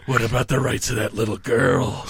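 low, quiet, lightly voiced tough cop; a hint of a cold cop. Harry answering the begging killer, low and quiet. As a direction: toss it back low and flat. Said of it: "he's just like".

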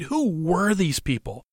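ordinary delivery

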